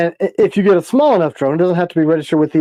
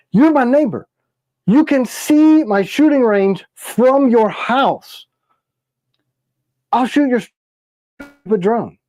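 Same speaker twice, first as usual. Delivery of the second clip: high exasperated scolding; 6 semitones higher, slower than usual. Pretend. Telling off the neighbor.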